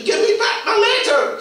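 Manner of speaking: high loud shout after the fox; desperate call. Acted. Harry shouting after the fox to give back his letter, high and loud. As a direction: yell after him as he runs.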